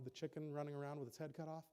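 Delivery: ordinary talk, nothing marked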